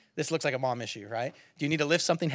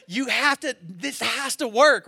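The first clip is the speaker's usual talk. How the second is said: higher, louder, slowed insistence; retold dad pleading with his son, frustrated; frustrated insisting; 7 semitones higher, 6 dB louder, slower than usual. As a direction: press the point, exasperated. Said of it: "And I'm getting frustrated".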